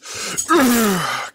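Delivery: high, strained falling grunt; a heavy straining groan. A narrator's reading. The villain strains and grunts heavily. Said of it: "Cassidy exclaimed heavily".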